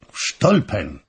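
a mild outburst of surprise at the name; exclaimed. Acted. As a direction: say it as a sharp, startled cry. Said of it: "zawołał August".

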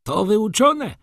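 high, loud retort; red-faced gossip. A narrator's reading. Red gossip woman to the older one, high indignant retort. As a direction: pitch it up sharply, loud. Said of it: "rzekła czerwona kumuszka".

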